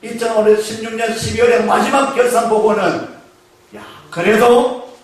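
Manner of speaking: normal talking voice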